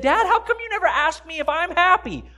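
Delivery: put-on high-pitched kid's complaint; plaintive child. Child went home and asked his father, plaintive. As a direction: put the question as a child to Dad.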